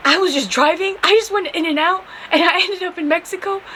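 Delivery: high-pitched, hurried self-defence; flustered pleading. Retold or imitated. Lost driver explaining herself to police officer, flustered pleading.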